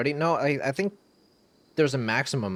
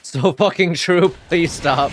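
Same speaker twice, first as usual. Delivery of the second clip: excited shout; 10 semitones higher, 12 dB louder than usual. Real, not acted. Laughing reaction after the song.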